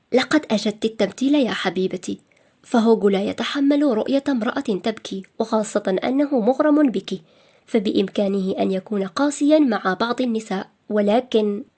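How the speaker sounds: ordinary delivery, nothing marked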